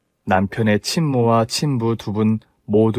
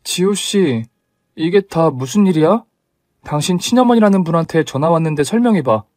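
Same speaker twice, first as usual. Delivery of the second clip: higher-pitched, demanding questioning; confronting; 5 semitones higher than usual. Acted. Bride phones her fiancé about his mother's call, confronting. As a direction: press for an explanation, pitched up. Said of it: "전화를 해서 따졌어요".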